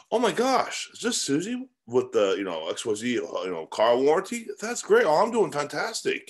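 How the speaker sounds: high, gushing fake enthusiasm; hammed-up thrilled reply on the phone; mock delight. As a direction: act overjoyed to hear from a telemarketer. Mock delighted reply to cold call.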